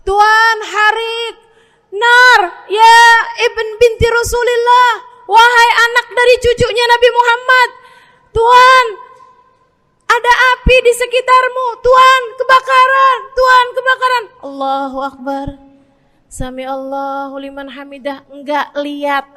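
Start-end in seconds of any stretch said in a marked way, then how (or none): 0.0-14.2 loud, high, frantic cries; panicked shouting. Retold or imitated. People scream to the praying master about the fire. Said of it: "Orang-orang pada teriak-teriak". 14.5-18.2 calm prayer. Acted. Master keeps praying calmly while the house burns.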